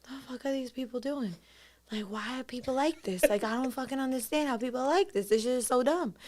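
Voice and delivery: quiet annoyed grumble under the breath; hushed, irritated inner voice; muttered inner rant. Retold or imitated. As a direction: complain quietly to yourself. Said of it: "and I'm just like".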